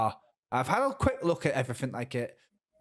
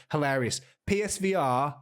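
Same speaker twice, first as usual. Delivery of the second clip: normal talking voice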